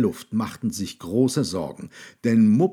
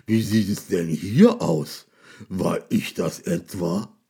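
monster voice; 3 semitones lower than usual. A narrator's reading. Monster shocked.

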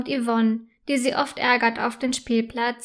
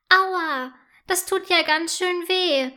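high, whimpering ouch; a child hurt, close to tears; pained cry; 6 semitones higher than usual. A narrator's reading. Girl cries out after falling on a stone. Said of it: "schreit sie und fängt an zu weinen".

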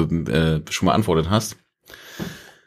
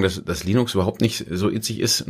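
usual speaking voice

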